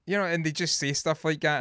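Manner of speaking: normal talking voice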